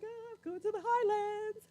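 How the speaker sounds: high, hammed-up fan excitement; giddy self-quote. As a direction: play yourself as an overexcited traveler. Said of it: "I was like".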